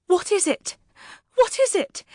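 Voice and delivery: a terrified lady gasping at the wailing; gasped panic. A narrator's reading. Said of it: "Missus Lennox gasped".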